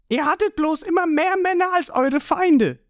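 very high, cocky retort; Ruffy. A narrator's reading. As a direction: throw it back high and taunting.

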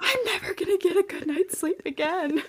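mock despair. Sleepless mom's despair.

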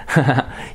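short amused laugh; a brief, easy chuckle; light chuckle. Real, not acted. Chuckles at the identical words.

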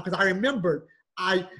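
usual speaking voice

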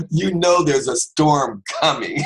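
high, loud, slow, laughing line; laughing joke. Real, not acted. Preacher joking to the congregation, laughing. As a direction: deliver it through the laugh.

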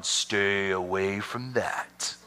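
drawn-out, low warning; low, slow "keep clear" voice; mock warning. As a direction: stretch it out slowly as a wary warning. Mock warning about another church.

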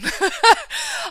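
a sudden high burst of laughter. Real, not acted. Laughing at how done she was with school, a high burst of laughter. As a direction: laugh it off, half rueful.

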